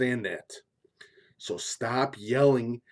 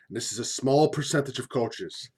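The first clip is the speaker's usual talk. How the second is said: usual speaking voice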